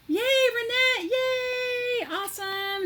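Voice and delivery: high, loud, thrilled yay; squealing with real joy at the package; excited cheer. Real, not acted. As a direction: cheer her friend's name out in delight. Thrilled the surprise package arrived.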